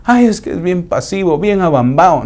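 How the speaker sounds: a mildly mocking tone about the dazed one; lightly voiced gossip sighing Ay. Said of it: "Tímido es diferente".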